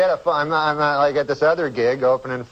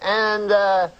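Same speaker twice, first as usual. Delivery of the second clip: high, slow Cosby voice; drawn-out high Cosby voice; Cosby-like agent voice; 7 semitones higher, slower than usual. Retold or imitated. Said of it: "you start to talk like him".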